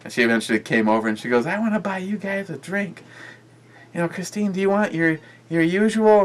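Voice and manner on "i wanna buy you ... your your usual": high-pitched voice for the friend; high, put-on quote of an eager offer; imitating a friend. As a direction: mimic her offering a drink, pitched way up. Acting out the tipsy friend's offer.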